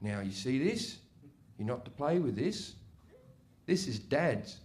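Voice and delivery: low, soft, measured warning; quietly stern dad. Retold or imitated. Dad holds it up and warns his son, quietly stern. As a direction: imitate a father laying down a rule under his breath.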